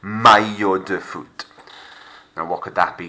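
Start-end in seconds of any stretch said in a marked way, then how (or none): 0.0-1.4 "maillot" said my-yo, no L; "maillot" with a Y glide in place of LL; LL as a Y sound. Shown as a demonstration. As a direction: isolate the tricky word for the class. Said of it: "It's a Y sort of sound".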